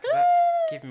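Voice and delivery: squeal of disgust. Real, not acted. Host squealing in disgust at the creepy stalker story.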